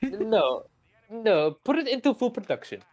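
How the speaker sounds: high-pitched put-on Russian accent; mock Soviet official. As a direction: dismiss it as a comic Soviet boss with a Russian accent. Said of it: "the Soviets were hilarious with their design philosophies".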